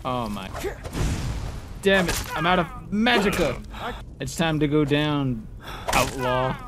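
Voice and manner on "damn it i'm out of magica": ordinary delivery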